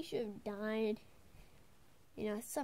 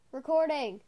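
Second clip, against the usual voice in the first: much louder sing-song call of the word "recording", falling at the end; 3 semitones higher, 9 dB louder than usual. Shown as a demonstration. Calling out "recording" to someone off camera, loudly.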